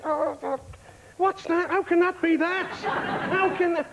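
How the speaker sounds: phone bill panic. Retold or imitated. Teller at home over the phone bill, hammed-up horror. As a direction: splutter at the bill in exaggerated horror. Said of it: "I say".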